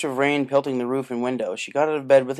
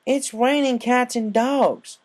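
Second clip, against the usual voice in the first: slow, high, loud scolding; chastising; 11 semitones higher, 6 dB louder, much slower than usual. Acted. Applejack scolding Rainbow for swimming in a storm, slow and high. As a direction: drag it out to scold her.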